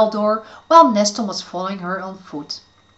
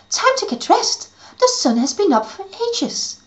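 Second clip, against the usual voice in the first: put-on very high, bright fairy girl; chirpy little fairy; 12 semitones higher than usual. Fairy Alice waking Neston.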